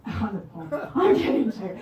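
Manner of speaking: amused self-correction breaking into laughter; real laugh over a blunder; laughing at oneself. Real, not acted. Laughing at own slip of tongue.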